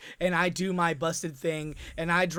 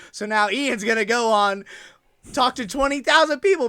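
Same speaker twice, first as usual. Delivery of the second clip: usual speaking voice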